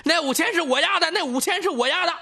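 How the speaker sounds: high, fast, insistent calling out; loud claim. A narrator's reading. Bettor squeezing forward claims the five thousand, loudly. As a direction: rattle it out high over a crowd. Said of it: "边挤我边大声说".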